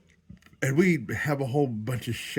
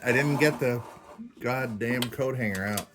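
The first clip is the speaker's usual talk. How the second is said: usual speaking voice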